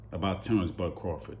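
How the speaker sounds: normal talking voice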